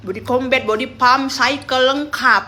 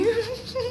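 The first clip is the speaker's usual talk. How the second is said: high laugh; 12 semitones higher, 6 dB quieter than usual. Real, not acted. Laughs while telling.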